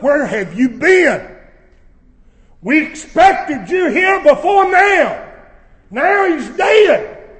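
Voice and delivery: loud, high reproachful cry; sisters crying out. Retold or imitated. Sisters crying out to Jesus, loud reproach. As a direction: shout it at Jesus in grief. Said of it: "here both sisters say".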